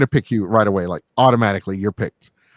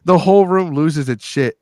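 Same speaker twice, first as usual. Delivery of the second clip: normal talking voice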